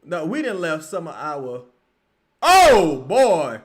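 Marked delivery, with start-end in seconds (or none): 2.4-3.6 높고 크게 터지는 놀람; loud surprised cry. Real, not acted. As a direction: shout it out at the wild shot. Reacting to a lucky shot.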